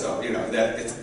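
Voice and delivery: usual speaking voice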